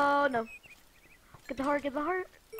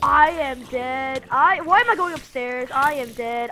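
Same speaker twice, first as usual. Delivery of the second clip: loud despair; 12 dB louder than usual. Real, not acted. Dying in game.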